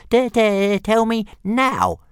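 high, slow stuttering dragon voice; squeaky stammering dragon; stammering little dragon. Acted. Little dragon stammering at Hiccup, high and slow.

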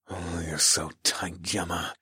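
low, slow, soft growl; hushed low seduction; seductive growl. A narrator's reading. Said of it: "I growled seductively".

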